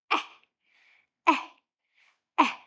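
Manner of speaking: Eh said as short open a, mouth wide, three times; short A sound. Shown as a demonstration. Teacher leading children through the apple sound, open short a. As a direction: drill Eh as the a in apple. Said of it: "Let's try it again".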